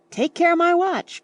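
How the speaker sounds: high, loud cry for his watch; alarmed cry. A narrator's reading. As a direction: yelp it in alarm. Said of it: "cried Mister Beebe".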